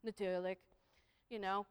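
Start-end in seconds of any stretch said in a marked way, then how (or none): 0.0-0.6 resigned agreement. Acted. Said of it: "they go".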